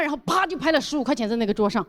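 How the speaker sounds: ordinary talk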